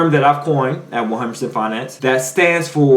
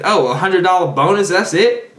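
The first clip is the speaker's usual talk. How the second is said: high, dismissive scoff; scoffing employee; 5 semitones higher than usual. Retold or imitated. Supervisor mimicking employees scoffing at a bonus, dismissive and high. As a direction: sniff at the bonus, voice up. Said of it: "they'll turn their nose up and say".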